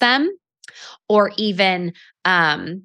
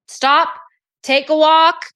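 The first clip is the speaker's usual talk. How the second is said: higher and louder, firmly insistent; emphatic urging; 8 semitones higher, 4 dB louder, slower than usual. Real, not acted. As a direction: stop them in their tracks. Urging listeners to pause.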